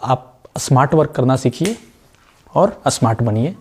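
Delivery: normal talking voice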